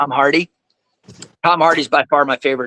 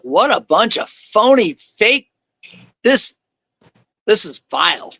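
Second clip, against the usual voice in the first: disgusted; 5 semitones higher, slower than usual. Retold or imitated. Recalled disgust at party.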